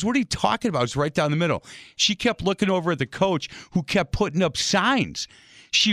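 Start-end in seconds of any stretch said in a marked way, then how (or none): 0.0-0.8 put-on high, indignant girl voice; lightly voiced sassy catcher; mock catcher protest. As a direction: scoff at the umpire's call.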